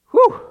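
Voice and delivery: loud high exhale of relief; loud whew of relief. Real, not acted. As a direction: blow out a big breath. Exhales after long reading.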